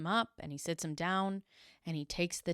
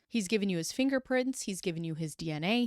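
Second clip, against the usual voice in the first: usual speaking voice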